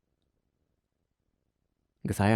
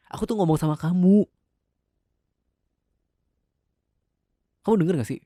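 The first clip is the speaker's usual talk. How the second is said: angry demand; 7 semitones higher, 4 dB louder than usual. Acted. Husband demands attention.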